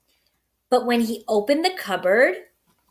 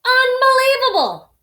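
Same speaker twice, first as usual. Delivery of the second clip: loud, high exasperated outburst; exasperated Bear; 13 semitones higher, 6 dB louder than usual. A narrator's reading. Bear finding the mouse again, exasperated outburst. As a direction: let it burst out in disbelief. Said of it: "rumbled Bear".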